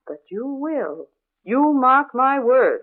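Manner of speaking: slow, heavy, foreboding words; slow ominous warning. Acted. Neighbour warning the new wife that she will hear the ghosts. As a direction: warn her gravely, word by word.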